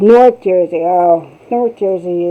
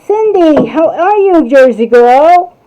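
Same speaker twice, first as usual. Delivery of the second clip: excited greeting; 6 semitones higher, 9 dB louder than usual. Real, not acted. Greeting a viewer by name.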